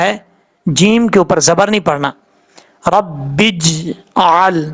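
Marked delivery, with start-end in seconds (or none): 2.9-4.7 slow رب بج آل, the ج bouncing with qalqala and no vowel after it; correct qalqala. Shown as a demonstration. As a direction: let the ج echo, then go straight on to آل. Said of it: "اس طریقے سے پڑھنا ہے".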